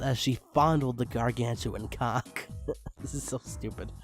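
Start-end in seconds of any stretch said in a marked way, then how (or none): none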